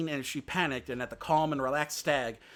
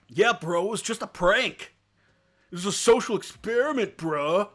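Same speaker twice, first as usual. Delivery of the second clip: bro voice; 7 semitones higher, 8 dB louder than usual. Acted. Narrator's jokey bro aside.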